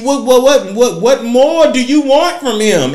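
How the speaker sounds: high, loud, slow indignant demand; exasperated rant. Real, not acted. Vlogger scolding critics, loud exasperated demand. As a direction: hammer the question at them.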